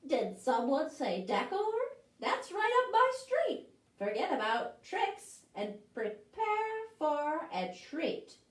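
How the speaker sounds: high excited pumpkin voice; delighted pumpkin. Acted. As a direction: perk up at the word decor. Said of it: "said Christopher Pumpkin".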